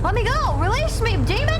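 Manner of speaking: high mock-dramatic plea; hammed-up possessed victim. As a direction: overact fighting off a demon. Game car stuck, hammed struggle.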